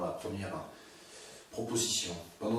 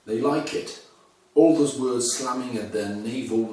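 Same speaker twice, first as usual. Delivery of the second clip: louder, measured poetry reading; poem recitation; 7 dB louder than usual. Acted. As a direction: read the poem out, projecting each line. Reads the poem aloud. (lecture de poème à voix haute, projetée)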